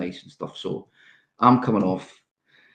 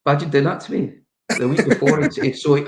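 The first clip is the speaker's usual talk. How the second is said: incredulous; 7 semitones higher, 5 dB louder than usual. Real, not acted. Outraged at being snubbed.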